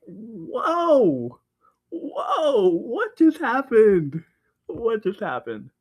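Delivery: high, loud, drawn-out disbelief; acted shock. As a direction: reel from a sudden plot twist, hammed up. Acts own shock at sudden death.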